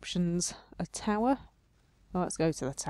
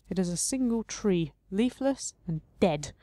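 ordinary delivery

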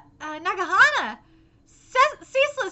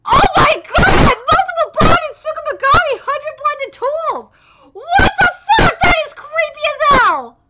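loud, high-pitched disbelief; shocked shouting; 4 semitones higher, 5 dB louder than usual. Real, not acted. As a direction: cry out, stunned by the reveal. Shocked reaction to the reveal.

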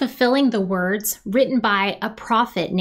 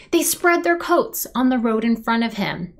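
ordinary delivery

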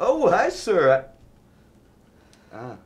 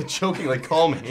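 laughing; 6 semitones higher, 9 dB louder than usual. Acted. Laughing at his own joke.

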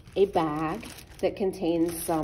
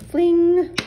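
high, loud launch cry; excited fling; 11 semitones higher, 12 dB louder than usual. Real, not acted. Showing kids the launch, a loud excited cry. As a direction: cheer it as it flies.

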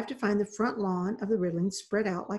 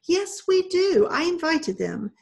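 much higher, louder, slowed insistence; arguing; 9 semitones higher, 5 dB louder than usual. A narrator's reading. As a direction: cut her sister off and insist. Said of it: "Miss Marjorie argued".

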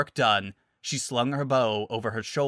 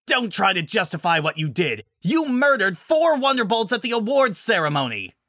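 shouting back; 9 semitones higher, 5 dB louder than usual. Acted.

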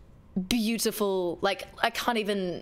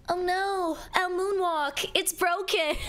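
mock lament; 8 semitones higher, slower than usual. Mock lament over broken dance.

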